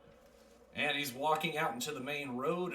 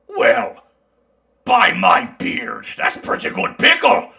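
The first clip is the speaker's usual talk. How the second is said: loud, high, delighted dwarf; 8 semitones higher, 15 dB louder, slower than usual. Acted. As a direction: bellow it like a thrilled dwarf. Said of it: "he takes a bite and".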